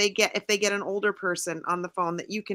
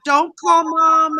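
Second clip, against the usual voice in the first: louder, higher, slow desperate plea; mock pleading cry; 7 semitones higher, 10 dB louder, much slower than usual. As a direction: beg her not to call Mom, hammed-up. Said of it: "starts to cry even harder".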